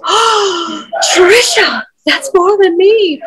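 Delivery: hammed-up high-pitched maternal dismay; shocked mom. Daughter imitating her mom hearing her weight, high and aghast. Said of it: "and she goes".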